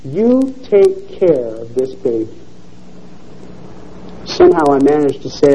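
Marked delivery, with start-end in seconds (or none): 0.0-2.3 lower, softer, slow command. A narrator's reading. Old man charges the mother, low, slow and commanding. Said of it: "in a commanding voice".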